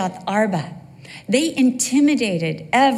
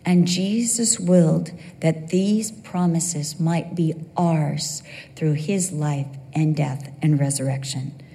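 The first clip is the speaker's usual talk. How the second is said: ordinary delivery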